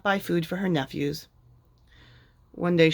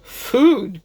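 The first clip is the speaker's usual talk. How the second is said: loud, high outraged "Food?"; incredulous cry; 6 semitones higher, 7 dB louder than usual. A narrator's reading. Lord Gur outraged at Minnie's request, loud. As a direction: spit it back in disbelief. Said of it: "cried Lord Gur".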